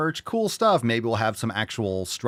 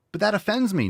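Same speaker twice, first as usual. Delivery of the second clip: mock whiny complaint; 10 semitones higher than usual. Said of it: "imitating an offended critic".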